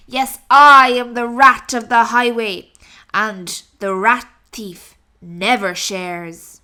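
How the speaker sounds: loud booming robber rat; thundering rat. Acted. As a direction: roar the demand like a bandit. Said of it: "who thundered".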